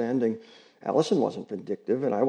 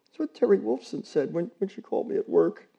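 halting, higher, near tears; breaking off; 8 semitones higher than usual. Acted. Allison struggling to speak about the dog, halting and upset. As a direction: stumble and catch yourself. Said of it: "had to break off a moment to gather herself".

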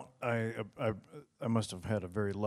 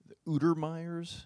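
questioning rise; 7 semitones higher than usual. Shown as a demonstration. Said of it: "Unter-, Untermeyers?".

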